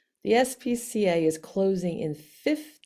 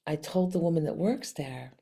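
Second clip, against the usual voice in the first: ordinary delivery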